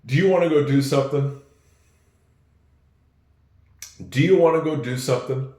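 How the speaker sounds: quick run-together "Do you want to go do something", wanna; relaxed everyday reduction; how we say it. Shown as a demonstration. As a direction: blend it fast the way people talk. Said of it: "We hear and we say".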